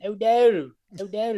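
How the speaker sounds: high, exaggerated Baltimore O; Baltimore accent. Shown as a demonstration. Cohost previewing his partner's read, mock Baltimore accent. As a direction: ham up the Baltimore accent on the name. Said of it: "that Baltimore accent".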